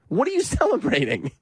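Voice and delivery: high-pitched, incredulous mock question; mock incredulity. Host repeating candidate's question to the crowd, heightened mock incredulity. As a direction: push the question up into laughing disbelief.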